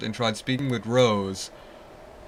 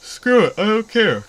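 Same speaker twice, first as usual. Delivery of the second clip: loud, high burst of giving up; exasperated outburst; 10 semitones higher, 9 dB louder than usual. Real, not acted. Gamer dismissing a tedious quest, exasperated shout. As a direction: fling it away, higher and louder.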